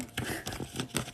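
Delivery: quiet airy chuckle; soft breathy laugh. Real, not acted. Reader laughing at her own sass, soft and airy. Laughs after sassy retort.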